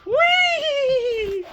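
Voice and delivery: high, loud gleeful whee with a laugh; horse whee. Acted. Grape the horse takes off with the bandit, high gleeful squeal. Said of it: "Grape goes".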